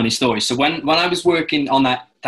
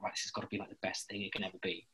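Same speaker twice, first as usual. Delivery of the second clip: murmured resolve; 3 semitones lower, 19 dB quieter, faster than usual. Retold or imitated. Speaker's inner resolve at work, murmured.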